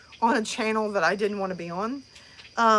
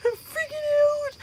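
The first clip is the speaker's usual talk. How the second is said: high-pitched panicked wail; freaking out. Retold or imitated. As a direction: imitate yourself in a rising panic. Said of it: "Stop freaking out".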